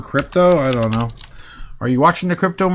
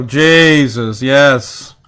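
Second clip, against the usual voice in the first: ordinary delivery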